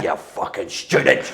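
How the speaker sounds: slow, drawn-out mock contempt; sneering Gorbals local. Gorbals locals jeering at a private-school kid, sneering. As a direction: spit it out like a hostile local.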